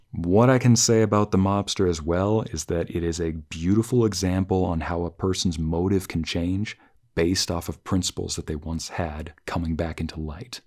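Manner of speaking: ordinary delivery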